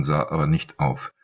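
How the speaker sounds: usual speaking voice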